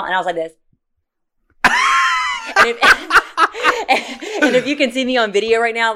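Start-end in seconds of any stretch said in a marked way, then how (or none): 1.6-2.5 high, shrieking burst of laughter. Real, not acted. Laughing about her mugshot pose. 2.5-5.9 talking through real laughter; laughing. Real, not acted. Laughing about her mugshot pose.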